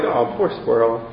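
low, soft murmur of pity; pitying sigh. Retold or imitated. Commuter seeing the squirrel again, low soft pity. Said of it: "you're like".

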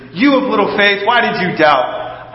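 Jesus scolding Peter for all to hear; a firm rebuke meant for the whole boat to hear; public rebuke. Retold or imitated. Jesus rebukes Peter before everyone, a firm public rebuke. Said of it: "right next to the boat so everybody else can hear".

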